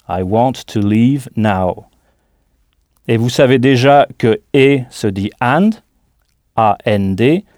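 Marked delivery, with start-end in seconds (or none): 0.1-1.8 slow, clear English model of I want to leave now; lentement, pour l'élève; slow model phrase. Shown as a demonstration. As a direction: say it slowly for learners.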